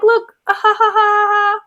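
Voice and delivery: high, put-on stagey laugh; Iago. Iago stirring up trouble, high fake laughter. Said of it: "He's just like".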